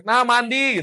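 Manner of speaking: high-pitched parental shout; mock yell. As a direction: yell at the child to come bathe. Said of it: "Sambil teriak". (pura-pura teriak, nada tinggi)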